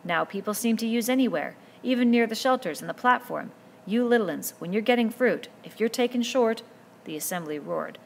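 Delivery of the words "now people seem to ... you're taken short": put-on a boy leader speaking up firmly to a noisy crowd; lightly voiced boy chief, louder than the narration; Ralph addressing the boys. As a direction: raise your voice to the assembly and hold their attention.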